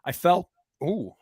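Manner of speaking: burp mid-sentence; a burp breaks the words. Real, not acted. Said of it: "I burped".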